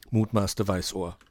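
usual speaking voice

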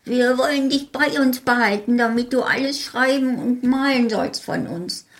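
put-on old little man. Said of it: "sagte ein altes Männlein".

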